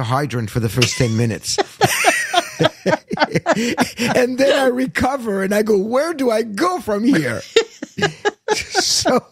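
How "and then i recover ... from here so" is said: high, laughing delivery, slowing on the question. Real, not acted. Guest joking to host about recovering from the introduction, laughing. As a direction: let laughter run through the bewildered question.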